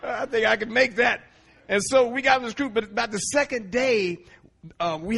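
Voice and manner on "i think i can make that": laughing. Retold or imitated. Accepting a free cruise.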